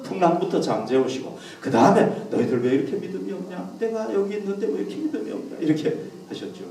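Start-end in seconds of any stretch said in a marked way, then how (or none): 2.3-5.6 soft, restrained chiding; gentle reproach. Acted. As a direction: chide the disciples softly, without scolding. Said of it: "예수님이 안 그러셨어요".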